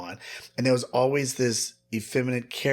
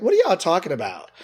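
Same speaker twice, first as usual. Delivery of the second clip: louder and higher call to another room; 7 semitones higher, 8 dB louder than usual. Retold or imitated. Nephew calling out to his aunt in the bedroom, loud and high. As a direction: shout the question across the house.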